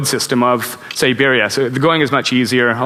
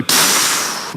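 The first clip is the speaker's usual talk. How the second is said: imitated tire hiss. Tire goes flat.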